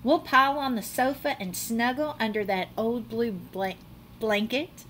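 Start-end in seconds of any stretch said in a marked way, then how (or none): none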